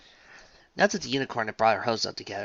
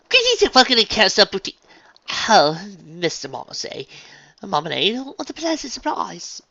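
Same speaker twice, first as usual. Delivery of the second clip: gushing welcome; 10 semitones higher, 9 dB louder than usual. A narrator's reading. Rarity gushing host.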